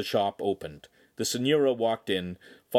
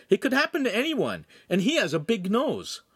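very high-pitched youth's voice; cheeky youth; 14 semitones higher, 4 dB louder than usual. A narrator's reading. Lincoln pipes up about the Podesta, cheeky and high. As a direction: pipe up high, butting in.

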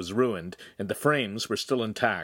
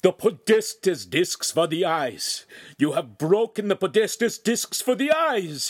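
enraged cry; 10 semitones higher, 5 dB louder than usual. A narrator's reading. Master horrified at broken lenses, crying out in rage. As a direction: cry out louder as outrage builds. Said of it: "he cried, visibly shaking".

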